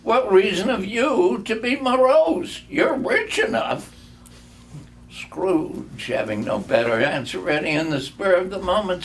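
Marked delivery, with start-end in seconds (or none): 0.0-3.9 high and loud, playful; gaily. A narrator's reading. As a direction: mirror the uncle's words back with cheer. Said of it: "returned the nephew gaily".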